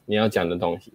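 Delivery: usual speaking voice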